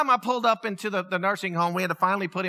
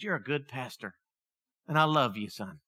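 tender last words; 8 semitones lower, 6 dB quieter than usual. Retold or imitated. Father's last words to son.

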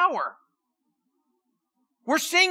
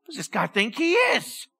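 ordinary talk

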